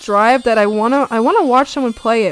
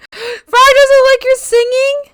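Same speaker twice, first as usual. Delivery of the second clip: gasping offended frog; 14 semitones higher, 8 dB louder than usual. Pretend.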